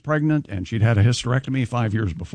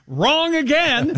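mock announcement; 12 semitones higher, much slower than usual. Teasing co-host with drawn-out line.

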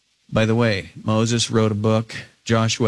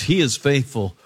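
normal talking voice

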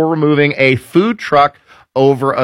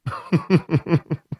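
chuckle; 5 semitones higher, 7 dB quieter than usual. Real, not acted. Host reacting to a risqué food truck slogan, chuckle. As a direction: let out a quiet little laugh.

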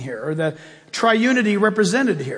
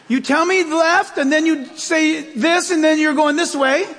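higher, laughing mock exasperation at the GPS; exasperated scolding; 7 semitones higher than usual. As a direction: scold the sat-nav, half laughing.

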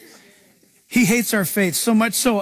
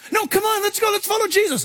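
high, insistent rallying call; high-pitched, enthusiastic coaxing; eager urging; 11 semitones higher than usual. Retold or imitated. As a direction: plead with them high and eagerly. Voices eager follower against doubters.

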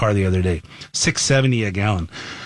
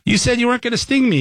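much higher, fast frog's betrayed squeal; dying frog; 11 semitones higher, faster than usual. Retold or imitated. Host voices the frog betrayed by the scorpion, higher and fast. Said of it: "as he's dying, goes".

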